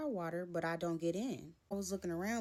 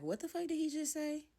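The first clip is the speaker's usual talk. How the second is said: high-pitched shocked question; stunned disbelief; 10 semitones higher than usual. Retold or imitated. Standing outside stunned by his threat, high disbelief.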